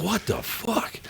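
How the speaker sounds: very soft, stunned retold reaction; hushed disbelief. Storyteller reliving his shock at the concert, hushed disbelief. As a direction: let it out under your breath, dumbfounded.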